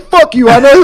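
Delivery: high, loud gloating taunt with a laugh; mock gloating. He taunts the guard on his way in, loud and gloating. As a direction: rub it in his face.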